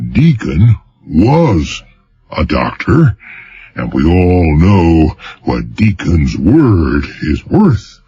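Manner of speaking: each word weighted, with a telling pause after; pompous governor, pausing after every stressed word; emphatic pauses. Acted. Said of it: "a telling pause after each word so emphasized".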